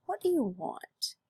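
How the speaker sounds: slow, soft, rising curiosity; curious, not angry. Shown as a demonstration. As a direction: ask it gently, intrigued. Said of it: "let me rephrase that".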